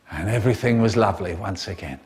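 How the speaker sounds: ordinary talk, nothing marked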